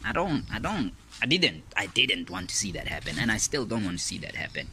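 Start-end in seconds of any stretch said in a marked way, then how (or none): none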